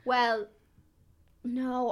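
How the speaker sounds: normal talking voice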